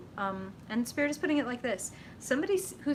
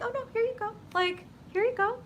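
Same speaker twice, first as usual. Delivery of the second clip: much higher, light giving voice; generous card voice; 13 semitones higher, faster than usual. Acted. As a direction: hand it over like a gift, pitched way up. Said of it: "And then Six of Cups is like".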